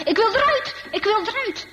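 high-pitched panicked cry, acted; storyteller's acted scream of fear, not full volume; frightened cry. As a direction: cry out like a trapped, terrified boy. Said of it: "hij schreeuwt het uit van angst".

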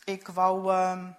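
put-on halting, hesitant boy, stuck on his words; stammering. A narrator's reading. Boy caught out by his uncle, stammering mildly. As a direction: stumble through it, lightly voiced. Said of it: "Ik hakkelde".

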